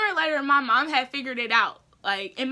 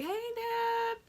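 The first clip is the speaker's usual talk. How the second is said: much higher, softer, slow and sheepish; nervous greeting; 10 semitones higher, 6 dB quieter, much slower than usual. Retold or imitated. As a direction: ease in timidly like you're hiding something. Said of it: "I was sweating bullets".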